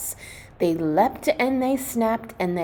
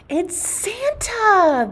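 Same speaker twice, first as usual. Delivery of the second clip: much higher, louder, slow excited yip; yipping pups; 13 semitones higher, 4 dB louder, slower than usual. Acted. The puppies hearing Santa's bells, yipping excitedly. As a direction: yip it like a thrilled puppy. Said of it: "the pups yipped".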